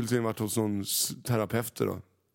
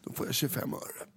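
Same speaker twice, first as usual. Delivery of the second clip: whispered aside; 2 semitones higher, 7 dB quieter than usual. Real, not acted.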